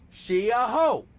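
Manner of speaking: loud, high cartoon-character impression; Riley. Shown as a demonstration. Asking partner to say it with them, loud Riley impression. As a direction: shout it out as a call to echo. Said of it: "get your Riley".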